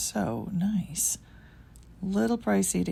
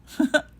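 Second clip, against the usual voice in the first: amused laugh; 8 semitones higher, 6 dB louder than usual. Real, not acted. Laughs at fuzzy item.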